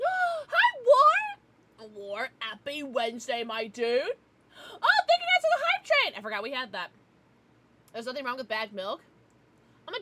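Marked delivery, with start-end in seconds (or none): 0.0-1.3 high, loud squeal of hello; gasping, thrilled hello; excited greeting. Real, not acted. Excited greeting to chat member. 3.8-6.1 high, loud gushing thanks; overjoyed thank-you to the chat; excited thanks. Real, not acted. Thanking chat for hype train.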